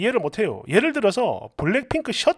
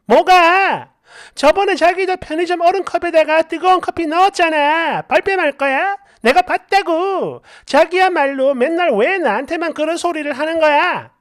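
higher-pitched, aggrieved comeback; indignant retort; 5 semitones higher than usual. Acted. Wife throwing the accusation back at husband, indignant retort. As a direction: argue back, stretching the accusations.

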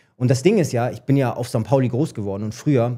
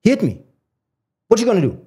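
rising, louder English complaint; complaining to coach; 3 dB louder than usual. Retold or imitated. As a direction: demand the coach act, getting louder. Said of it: "ich sag so zum Trainer".

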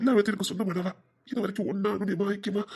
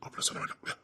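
coaxing whisper; 11 dB quieter than usual. Acted. Man coaxing the crying girl to sit, whispered. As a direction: whisper it close to her ear.

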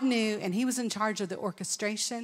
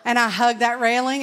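laughing loudly through the words; laughing retelling; 2 semitones higher, 8 dB louder than usual. Retold or imitated. Preacher telling the crowd, laughing out loud. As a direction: chuckle as you tell it.

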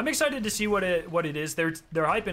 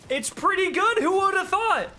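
high, loud, playful exclamation; put-on loud high squeal of wonder; mock amazement; 5 dB louder than usual. Mock amazement at being rewarded.

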